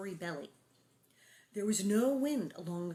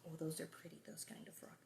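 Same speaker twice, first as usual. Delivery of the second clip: whispered aside; 4 semitones lower, 16 dB quieter, faster than usual. Real, not acted. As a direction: whisper it softly.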